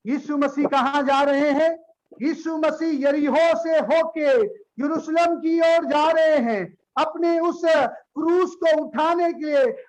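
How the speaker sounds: ordinary talk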